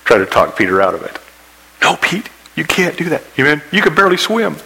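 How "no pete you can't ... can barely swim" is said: high-pitched mock pleading with a friend; protesting disciples. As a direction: talk him out of it, pitched well up. Acted disciples dissuading Peter.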